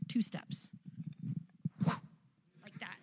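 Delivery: breathy whisper aside. Real, not acted. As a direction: drop to a whisper. Whispered aside about cart.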